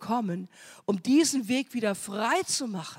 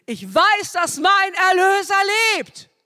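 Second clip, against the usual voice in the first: loud, much higher triumphant cry; Job crying out; 10 semitones higher, 10 dB louder than usual. Acted. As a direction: shout it out as a triumphant confession. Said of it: "dann ruft er mittendrin". (Hiob ruft laut und hoch seinen Glauben aus)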